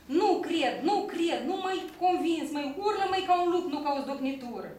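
loud, high-pitched angry outburst; angry yelling. Acted. Shepherd rejecting his pupil's wolf howl, shouting angrily. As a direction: shout it crossly, hammering out each demand. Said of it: "Vasile supărat, iar zgheri".

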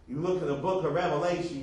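normal talking voice

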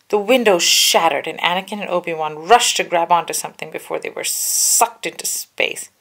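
ordinary delivery, nothing marked

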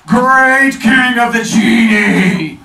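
booming slow genie; loud, slow and pleading. Acted. Genie begging the king.

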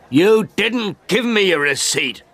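high, slow outraged shout. A narrator's reading. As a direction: yell it in disbelief at the cheat. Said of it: "I shouted".

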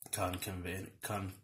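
usual speaking voice

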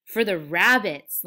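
slow, loud, joyful shout; drawn-out gleeful cry. A narrator's reading. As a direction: shout it with delight, stretched out. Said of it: "Lenny shouted".

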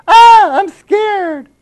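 squeaky falsetto fake fright; mock scared. Preacher mocking the timid congregation with a high falsetto squeal. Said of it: "Most of you go".

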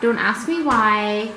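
ordinary delivery

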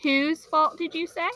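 slow, high, lightly voiced old man; a hint of an old shopkeeper, slowly; old storekeeper. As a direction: storekeeper lightly feigning surprise. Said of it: "the storekeeper exclaimed".

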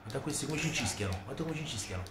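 ordinary talk

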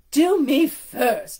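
loud, slow, demanding; bossy order. Acted. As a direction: drag out the demand. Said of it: "Largessa ordered".

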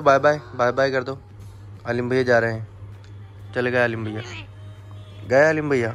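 ordinary talk, nothing marked

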